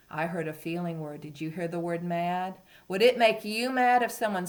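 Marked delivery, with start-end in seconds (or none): none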